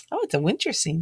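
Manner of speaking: ordinary delivery, nothing marked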